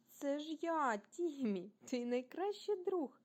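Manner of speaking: high, loud, happy announcement; hearty reassurance. A narrator's reading. Timmy reveals himself to Rasmus, heartily reassuring. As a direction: announce yourself brightly, stretching the last words.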